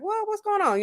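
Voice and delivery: high-pitched imitation of asking a friend; concerned check-in. Host replying to a friend's bad-day text, acted high-pitched concern. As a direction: lift the voice high, as if acting out a phone reply. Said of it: "And I'm like".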